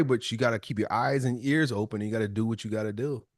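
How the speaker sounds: ordinary talk, nothing marked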